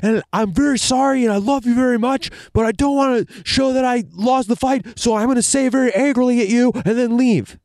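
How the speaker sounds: high, loud put-on voice of a fake-calm brother; squeaky overpolite apology before storming off; a hammed-up high voice, sweetly sorry yet angry; mock sincere apology. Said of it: "he ends up being like".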